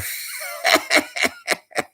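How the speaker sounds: very high-pitched voiceless cackle; high squealing laugh. Real, not acted. Laughing after mocking a fellow streamer, high squeal.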